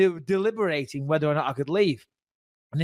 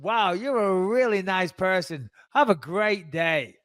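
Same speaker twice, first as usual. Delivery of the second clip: slow, drawn-out mock niceness; exaggeratedly pleasant, lingering on each phrase; big-smile sarcasm; 3 semitones higher, slower than usual. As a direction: say it through a big fake smile. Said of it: "with a big smile on my face".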